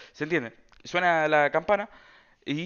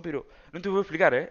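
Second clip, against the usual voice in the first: ordinary delivery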